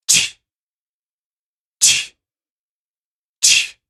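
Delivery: unvoiced tch tch tch, slowly spaced; imitated clicking noise. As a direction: mimic a strange click from the kitchen. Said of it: "it was like".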